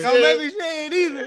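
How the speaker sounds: warning broken by laughter; laughing warning. Real, not acted. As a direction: say it while cracking up. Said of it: "repeats it".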